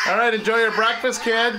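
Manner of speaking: loud, high call to the animals. Real, not acted. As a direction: call out cheerfully across the yard. Calling out to the animals.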